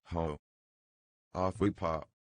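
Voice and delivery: ordinary delivery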